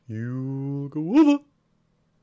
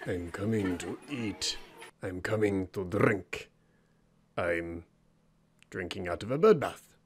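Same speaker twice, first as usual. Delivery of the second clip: much lower, growly beast voice; deep animal voice; 9 semitones lower, 8 dB quieter than usual. Acted. The animal heads for the bird bath in a deep beast voice. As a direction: lumber in as a big hungry creature.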